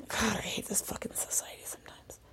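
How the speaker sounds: soft exasperated whisper; whispered gripe. Real, not acted. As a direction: breathe it out under your breath. Muttered complaint.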